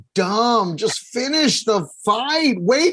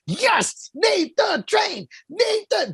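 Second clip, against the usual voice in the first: high, drawn-out cheer for the fighter; 9 semitones higher, much slower than usual. Retold or imitated. Scene: fan cheering Nate in second round, drawn-out cheer. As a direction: chant his name like a fan in the stands. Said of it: "I'm like".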